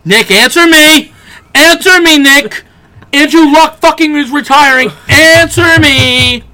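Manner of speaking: high, loud, desperate calling out; frantic yelling. Retold or imitated. Calling upstairs to Nick about the retirement news, frantic yelling. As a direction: yell up the stairs for your friend to answer. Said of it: "I was like".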